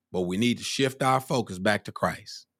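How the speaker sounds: usual speaking voice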